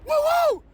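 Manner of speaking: high, loud joyful whoop; ecstatic whoop. Real, not acted. Morning preacher whooping for his viewers, ecstatic whoop. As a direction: let out a wild happy whoop. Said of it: "when I get super excited".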